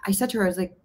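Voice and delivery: normal talking voice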